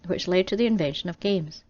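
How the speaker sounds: ordinary delivery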